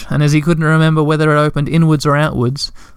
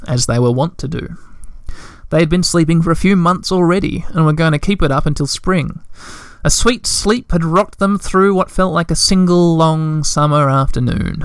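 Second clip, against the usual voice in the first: ordinary delivery, nothing marked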